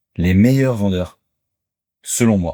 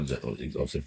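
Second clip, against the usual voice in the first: low, soft podcast-voice imitation; imitating partner's voice; 7 semitones lower, 15 dB quieter than usual. Podcast host trying to copy the guest's voice, low imitation. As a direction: try on your partner's deep voice. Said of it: "J'essaie de prendre ta voix".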